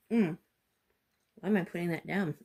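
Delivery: ordinary talk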